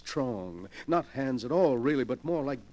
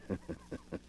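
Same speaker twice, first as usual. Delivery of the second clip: breathy, much softer evil chuckle; soft sinister chuckle; 11 dB quieter than usual. Acted. Collector gloating that nothing can be proved, soft chuckle. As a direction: chuckle under your breath, smug. Villain gloats, unprovable crime.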